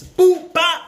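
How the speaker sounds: loud high mock punches; punch sound effects. Kid acting out his two-piece punch. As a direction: smack out the two hits.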